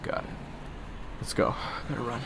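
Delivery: ordinary delivery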